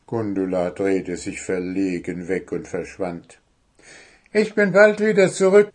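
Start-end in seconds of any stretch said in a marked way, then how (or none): 4.3-5.7 high, loud, fast crow voice; quick promise from the crow; crow promising. Acted. Crow promises the friends a quick return. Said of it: "versprach sie".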